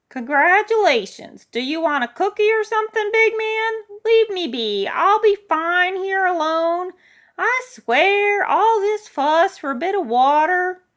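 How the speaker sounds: put-on very high, loud cranky old lady; mock the official, then wave them off; scornful, high-pitched elderly shout; sarcastic old woman. Old woman mocks the rescuer.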